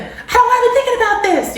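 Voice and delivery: high, loud, fast laughing whine; frustrated whine. Retold or imitated. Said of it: "instead of being like".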